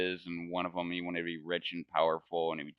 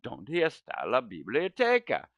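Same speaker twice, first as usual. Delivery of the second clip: classroom Spanish; 4 semitones higher than usual. Retold or imitated. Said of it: "things they would say in Spanish class".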